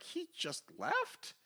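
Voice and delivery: very high, slow squeak of disbelief; Doctor Doom incredulous. Acted. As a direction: stretch it upward in mock astonishment. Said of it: "Doctor Doom's like".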